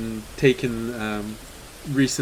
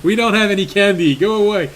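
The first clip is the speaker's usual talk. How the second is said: quick, raised, shooing voice; grumpy priest; 6 semitones higher, 5 dB louder, faster than usual. Acted. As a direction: brush them off fast and high.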